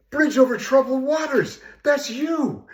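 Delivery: high, loud burst of recognition; excited recognition. Retold or imitated. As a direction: blurt it out high and loud, starstruck. Excitedly recognizing a famous singer.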